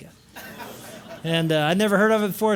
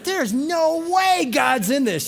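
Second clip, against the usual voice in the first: mock-angry raised voice, higher pitch; retold screaming; 7 semitones higher, much slower than usual. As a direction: relive the tantrum with mild heat, pitched up. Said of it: "I'm screaming".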